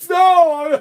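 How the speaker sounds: high, loud desperate cry; panicked scream. Retold or imitated. Kid screams at being arrested.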